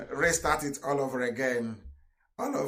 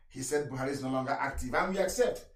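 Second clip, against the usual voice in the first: normal talking voice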